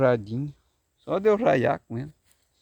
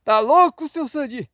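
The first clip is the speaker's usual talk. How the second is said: much higher and louder parrot cry; parrot shouting; 8 semitones higher, 5 dB louder than usual. Acted. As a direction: squawk it out in protest. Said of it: "O papagaio gritou".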